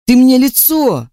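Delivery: high furious shriek on мне. A narrator's reading. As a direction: scream мне, pitch jumping high. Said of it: "и заорал".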